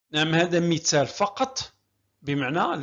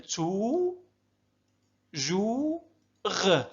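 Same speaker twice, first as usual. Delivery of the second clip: "تو، جو، غ" drawn out, with pauses between; syllable by syllable; 2 semitones higher, much slower than usual. Shown as a demonstration. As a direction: stretch each syllable of "تو، جو، غ" separately. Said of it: "هكذا نقسم الكلمة حتى نسهل قراءتها". (نطق "تو، جو، غ" مقطعًا مقطعًا ببطء)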